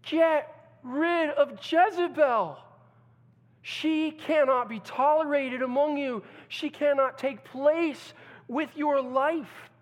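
loud, high, slow decree; Jesus commanding his bride; stern command. Acted. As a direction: lay down the law. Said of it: "is telling Thyatira, his bride".

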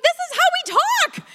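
very high, loud, amused outburst; exclaimed protest. Retold or imitated. Wife and mom cracking up at husband, loud high exclaiming. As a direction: shout it way up high in comic protest.

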